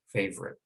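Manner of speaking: "Favorite" in two syllables, FAV-rit; middle vowel dropped. Shown as a demonstration. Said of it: "it's gone".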